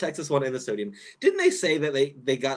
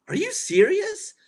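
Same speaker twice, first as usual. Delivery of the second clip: shrill, much higher, drawn-out are you serious; disbelief; 4 dB louder, slower than usual. Retold or imitated. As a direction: squeal it out in shocked disbelief. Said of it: "I was just like".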